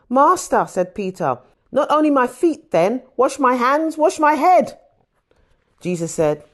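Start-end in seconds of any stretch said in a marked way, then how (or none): 0.1-0.7 high, loud cry of Master; excited plea; eager outburst. Acted. Peter pleads, eager. 1.7-4.8 higher and louder, building to head; excited, building plea; eager pleading. Acted. Peter begs for full washing.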